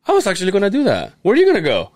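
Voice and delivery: quick, high retold sister; imitating sister. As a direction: lift the pitch to mimic a puzzled sister. Acting out sister's question.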